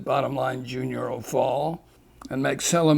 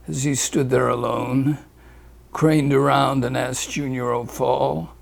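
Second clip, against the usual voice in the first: plain reading voice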